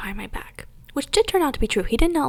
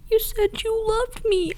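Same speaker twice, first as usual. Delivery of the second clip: much higher, slow imitation of a tearful ex; hammed-up teary boyfriend pleading; mock crying plea; 12 semitones higher, slower than usual. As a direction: whine it like he's about to sob. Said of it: "He started crying".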